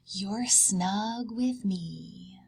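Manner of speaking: slow soothing whisper. Acted. Mama Bear comforting cubs, slow whisper. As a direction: whisper it slowly like tucking in. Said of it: "whispered Mama Bear".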